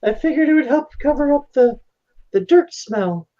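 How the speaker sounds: high-pitched, sheepish put-on voice; embarrassed daughter covering up, voice pitched high; blushing daughter's excuse. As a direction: play a girl improvising an excuse, haltingly. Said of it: "And she blushes".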